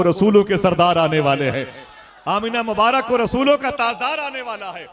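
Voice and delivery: counting months then rising to a loud, high shouted blessing; preacher climaxing the good news; building to a shout. Acted. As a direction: build from fervent to full-throated shout. Preaching Amina's dreams building.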